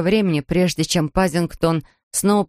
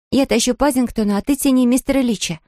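put-on higher-pitched girl giving orders; raised, urgent command; 5 semitones higher than usual. A narrator's reading. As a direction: order a helper into action. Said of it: "скомандовала Джуди".

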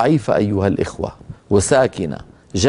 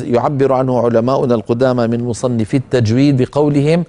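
ordinary talk

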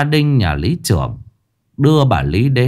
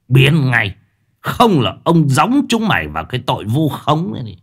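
put-on shouting old village chief chasing kids off; angry old official; 3 semitones higher than usual. Old official yelling at the children, furious. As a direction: bark at the brats to get lost.

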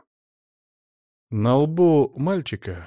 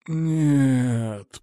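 wounded soldier; 3 semitones higher than usual. A narrator's reading. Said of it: "простонал боец".

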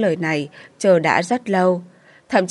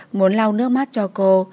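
ordinary delivery

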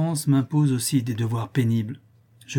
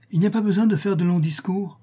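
high, impassioned interruption; very vivid retort; 7 semitones higher than usual. A narrator's reading. Countess cutting short the baron's reply, very lively. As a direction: cut in with lively urgency. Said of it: "reprit-elle encore très vivement".

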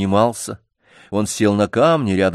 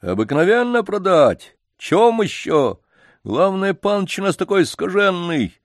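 put-on much higher, lively chatter about the spoiled boy; gossiping janitor; 8 semitones higher, 4 dB louder than usual. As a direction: gush about the master's family as a servant. Janitor voiced in story.

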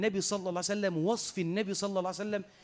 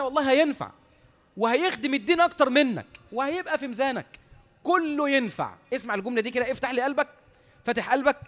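raised, urgent sermon voice; emphatic preaching; 6 semitones higher, 6 dB louder than usual. Real, not acted. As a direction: drive the maxim home to the congregation.

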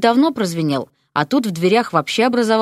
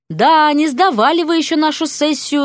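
higher, amused taunt; smirking remark; 8 semitones higher than usual. A narrator's reading. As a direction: tease them with a knowing smirk. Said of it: "усмехнулся Кристофер".

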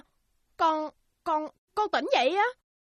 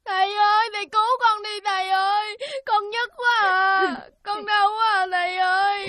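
crying out to the master, hurting; a sick child begging for help; pained wail. Acted. Sick son wailing in pain.

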